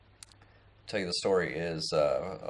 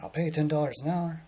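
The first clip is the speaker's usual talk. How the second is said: higher-pitched imitation of a pitch; retold eager offer; 8 semitones higher than usual. Old man offering pay to hold a sign, higher-pitched offer. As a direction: make the offer in a raised voice.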